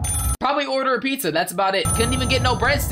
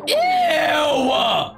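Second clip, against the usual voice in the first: disgusted shout; 4 semitones higher than usual. Real, not acted. Disgusted at creepy game character.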